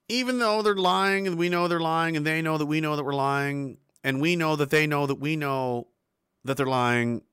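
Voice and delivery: usual speaking voice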